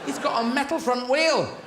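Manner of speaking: high, slow outraged disbelief; hammed-up dismayed realisation; exasperated discovery. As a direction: wail it as the dog who has been cheated. Dog discovers the rabbit is fake.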